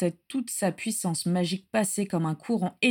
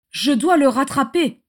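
higher, louder and urgent; insisting loudly; 6 semitones higher, 8 dB louder than usual. A narrator's reading. Regulus insisting to Bellatrix, urgent and loud. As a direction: push it out, desperate to chase him. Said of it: "insista Régulus".

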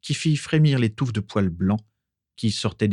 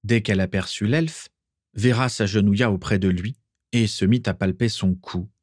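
ordinary delivery, nothing marked

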